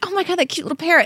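fast, high gush over a cute bird; fast, high fond gush on the bus. Retold or imitated. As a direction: coo at the parrot, rushing. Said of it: "And I was like".

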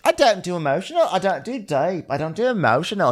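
high, fast mock insistence; defensive girlfriend. Girlfriend insisting she's not emotional, high mock huff. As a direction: chant it high and huffy. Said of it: "she's like".

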